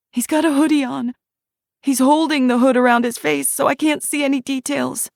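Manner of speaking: put-on whisper-fast fear of a child hiding; terrified child. Shiloh as a child hiding from the monster, terrified high voice.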